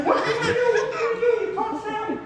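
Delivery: high-pitched retold amazement; astonished onlookers. Storyteller voicing people who come running, astonished.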